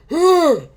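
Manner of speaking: coughing hard, choking on a drink; sudden coughing fit. Real, not acted. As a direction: cough it out like it went down wrong. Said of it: "It went out the wrong pipe".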